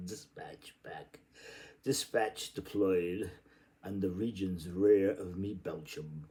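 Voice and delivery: ordinary delivery, nothing marked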